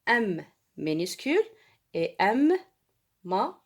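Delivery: "majuscule" split as ma, ju, scule with long pauses; syllable-by-syllable dictation. Shown as a demonstration. Teacher writing for pupils, widely spaced dictation. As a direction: say "majuscule" one isolated syllable at a time.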